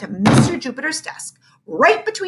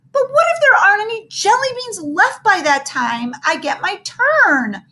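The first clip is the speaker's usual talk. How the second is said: whimpering; 11 semitones higher, 4 dB louder than usual. Acted. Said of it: "Ham was whimpering now".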